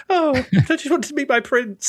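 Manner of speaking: high, lightly put-on princess whine; a hint of a wistful fairy-tale princess; mock Cinderella. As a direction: play a simpering princess pleading. Voicing Cinderella mockingly.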